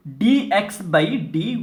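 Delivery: ordinary talk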